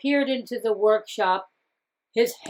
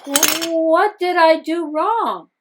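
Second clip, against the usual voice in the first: higher, louder, slow dismayed cry; 6 semitones higher, 6 dB louder, slower than usual. Acted. Tokuzo finding his cracked cats, higher dismayed cry. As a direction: wail over the broken cats.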